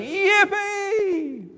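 high, drawn-out squeal of delight; whooping for joy. Acted. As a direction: let out a long joyful whoop. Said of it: "Elizabeth putting down whatever it was she was holding and going".

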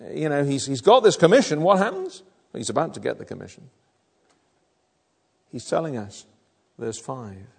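usual speaking voice

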